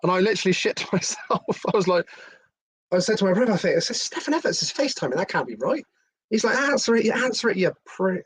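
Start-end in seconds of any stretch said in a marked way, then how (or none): 3.9-5.9 can't believe who's calling; a jolt of retold disbelief; shocked disbelief. Disbelief on seeing the call. 6.6-8.2 high, pushy mock urging; imitating the brother, high and urgent. Brother urging him to answer.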